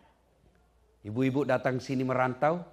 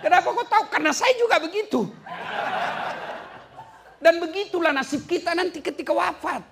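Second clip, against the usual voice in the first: high, loud, laughing jab at the congregation; teasing them while laughing at himself; laughing mockery; 7 dB louder than usual. Preacher teasing the congregation about their sticker prayers, laughing and high. Mocking own group's lazy prayers.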